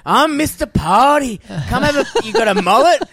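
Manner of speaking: put-on high, loud drunken challenge; taunting drunk. Drunk mate goading about his mullet, high loud taunt. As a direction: goad him like a sloshed bully. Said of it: "He was, like, taunting me".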